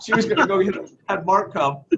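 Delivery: ordinary delivery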